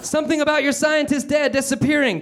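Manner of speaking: high, strained effortful voice; grunted. Acted. Calvin answering Meg about her missing dad, high strained grunt. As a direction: squeeze it out high under strain. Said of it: "Calvin grunted".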